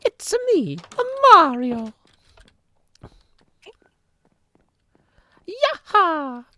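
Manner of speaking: very high, loud cartoon Italian squeal; Mario. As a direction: whoop it like an excited game hero. Answers with own Mario impression.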